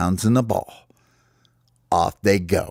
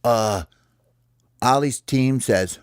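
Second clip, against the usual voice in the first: usual speaking voice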